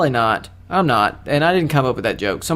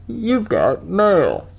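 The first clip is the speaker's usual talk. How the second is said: slow, higher put-on announcement; drawn-out imitation of the AOL voice; AOL mail greeting; 5 semitones higher, much slower than usual. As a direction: stretch it out like a computer greeting. Recites the AOL catchphrase, then plain repeat.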